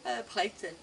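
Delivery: yawning. Real, not acted. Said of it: "I'm yawning again".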